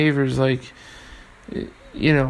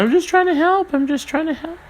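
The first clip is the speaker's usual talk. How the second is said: high-pitched mocking whine of an excuse; mocking imitation. Meddling relative defending their comments to you, mocking high voice. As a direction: squeak it out, mimicking someone protesting their innocence.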